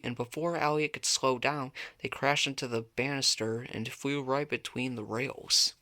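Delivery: ordinary delivery, nothing marked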